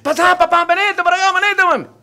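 high, loud, chummy calling out; hearty welcome to buddies. Retold or imitated. Father welcoming his drinking buddies home, high loud chummy call. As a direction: wave the buddies in like old pals.

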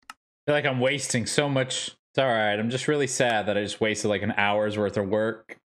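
ordinary talk

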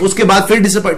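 ordinary talk

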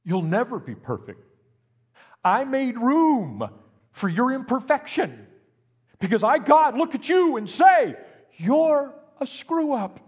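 God booming out acceptance to his son; God speaking. Retold or imitated. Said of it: "and said".

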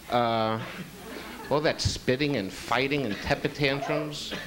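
ordinary delivery

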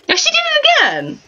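high squeal of exasperation; high fed-up squeal at the frozen game; exasperated squeal. Real, not acted.

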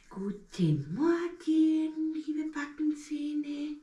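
high, very slow greeting to the molars; talking to teeth. Pretend. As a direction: coo to the molars to wake them. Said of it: "Seid ihr auch wach".

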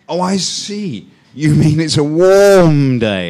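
high, drawn-out dawning understanding; mock realisation. Teacher pretending to finally understand student, mock realisation. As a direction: stretch WARM out loud and high as you catch on.

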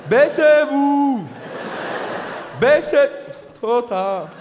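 hammed-up Swiss accent, high and loud, dragging the words; mock Swiss action hero. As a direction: play a slow Swiss commando yelling orders.

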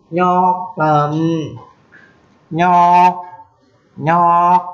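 "Nhọc lắm" lên cao, nhọc vừa nặng vừa sắc; nhấn "nhọc" cao và gắt; Mường nhọc. Shown as a demonstration. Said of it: "vừa có dấu nặng, vừa có dấu sắc". ("nhọc" dấu nặng lẫn dấu sắc)

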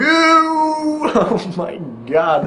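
laughing high, very slow; cracking up mid-take. Real, not acted.

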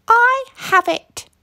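high, bright burst of an idea; light up with the idea; wife hitting on a plan; excited exclamation. Acted. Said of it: "she exclaimed".

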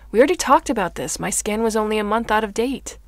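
loud, fast, angry retort; exasperated outburst. Acted. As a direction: snap back at speed.

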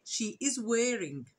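slow, clear model answer, leaning on wearing. Shown as a demonstration. Teacher giving the corrected answer slowly to students. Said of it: "يبقى she إيه؟".

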